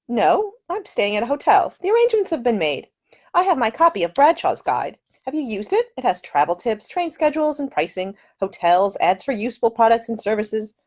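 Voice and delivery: put-on a polite, earnest young Englishwoman; young lady's voice. A narrator's reading. The lady explains arrangements.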